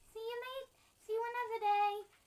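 high sing-out farewell; high character voice. Pretend. Granny seeing grandchild off, high put-on voice. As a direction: pipe the goodbye up high.

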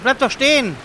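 loud yell after a fleeing creature; shouting. Real, not acted. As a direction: call out sharply.

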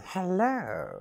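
high squeaky dummy voice; puppet voice. Acted. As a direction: ventriloquist dummy talking. Ventriloquist's puppet Sam greets.

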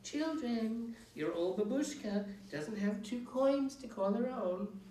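changed tone. A narrator's reading. Witch pleading poverty to the children, wheedling. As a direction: play the poor old babushka. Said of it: "she changed her tone".

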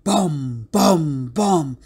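booming, falling sound-effect words; storybook monster footsteps; heavy footstep booms. A narrator's reading. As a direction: sound out each heavy footfall.

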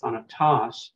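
thana-TOS, last syllable stressed in thanatos; misplaced final stress. Shown as a demonstration. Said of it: "You, you wouldn't say".

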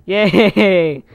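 loud drawn-out yay; cheer. Real, not acted. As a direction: cheer it out loudly. Cheers as tree chopping starts.

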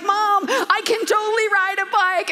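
put-on high, loud thrilled child; imitating a thrilled boy; excited kid. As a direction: burst with pride like a kid. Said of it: "He's like".

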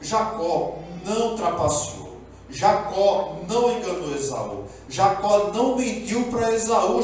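each "não" hit hard and drawn out, slowly; stress on não. Real, not acted. Preacher to his congregation, hammering "não" slowly. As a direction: lean on "não" in every line as the preacher insists.

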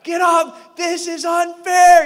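high, drawn-out outraged shout; loud, freaked-out protest, stretched out; yelling. Retold or imitated. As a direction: holler the injustice at full force. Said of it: "I'm yelling".

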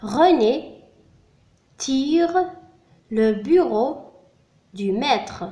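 slow word-by-word dictation; slow, measured French dictation reading. Shown as a demonstration. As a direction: dictate each word slowly, with long pauses. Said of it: "إذن الجملة هي".

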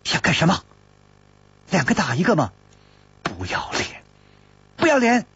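high, indignant shouting at him. Acted. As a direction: yell it out, outraged. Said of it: "忍不住大叫起来".

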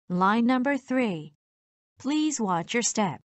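normal talking voice

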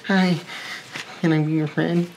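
high, timid-fan put-on voice; small hammed-up admirer voice; mock starstruck fan. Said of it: "then maybe I'll be like".

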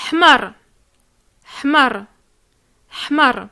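hmar clipped with no drawn A; short hmar. Shown as a demonstration. Teacher warning learners to keep hmar short. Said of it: "Il faut pas prolonger le A".

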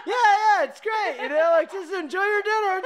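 high, bright forced reassurance; mock chirpy phone voice; fake cheerful. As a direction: pretend all is fine on the phone. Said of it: "And I'm like".

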